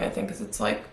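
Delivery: cracked voice fading to breath; voice cracking. Real, not acted. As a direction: voice breaking into near whisper. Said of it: "my voice keeps cracking".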